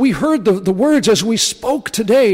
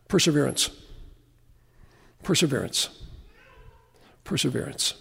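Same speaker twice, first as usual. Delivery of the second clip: each take lower and softer until it fades away; fading repetition; 5 semitones lower, 9 dB quieter, faster than usual. Acted. Preacher fading out on the key word, softer each time. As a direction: let the word die away. Repeated word ending sermon point.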